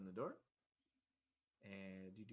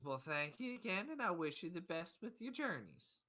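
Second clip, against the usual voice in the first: higher, louder farewell; high-voiced, hearty goodbye; grateful father; 8 semitones higher, 6 dB louder than usual. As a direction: send them off with thanks in a lifted voice. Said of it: "I said".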